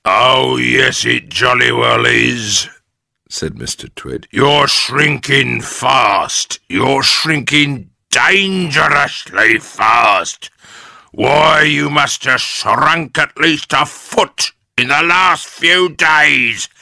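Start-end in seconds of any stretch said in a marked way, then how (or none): 0.0-2.7 loud, slow, gloating insistence; raised, drawn-out character voice; Mr Twit. A narrator's reading. Mister Twit insists, taunting her. 4.3-16.7 slow, loud, higher gloating voice; big menacing taunt, building; Mr Twit. A narrator's reading. Mister Twit torments his wife.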